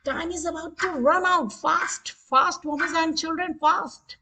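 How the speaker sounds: high-pitched hurry-up as time runs out; pressing game-host countdown; urging on. Pretend. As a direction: chivvy the players to answer. Quiz timer running out, hurrying players.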